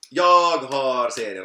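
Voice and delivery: slow, loud, stretched-out announcement; playfully drawn out. Real, not acted. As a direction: stretch each word out loudly. Playfully announces having series to tip. (utdraget och högt, lekfullt)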